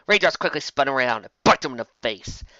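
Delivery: usual speaking voice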